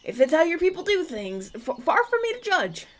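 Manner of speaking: flustered. Acted. Backpedalling after offending hosts.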